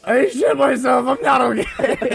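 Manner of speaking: high, drawn-out mock wail; hammed-up anguished cry through the door; distressed wail. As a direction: shout it slowly, near laughing.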